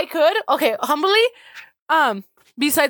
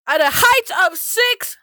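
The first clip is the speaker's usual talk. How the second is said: slow, raised ring announcer stats; hype announcer; 4 semitones higher, slower than usual. Pretend. Announcing a player's height, hammed-up announcer. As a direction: stretch it out like a ring introduction. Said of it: "I should be an announcer".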